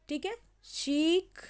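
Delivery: slow, with the ee of शीख drawn out; teacher repeating शीख slowly; slow demo. Shown as a demonstration. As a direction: model शीख slowly for the class. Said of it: "वो long होता है".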